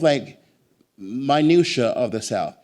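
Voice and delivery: usual speaking voice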